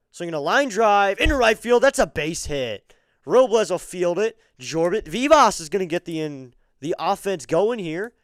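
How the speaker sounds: loud, high play-by-play burst on the hit; rising excitement calling the line drive; excited call. Real, not acted. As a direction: call the base hit with a fan's thrill. Live call of a base hit.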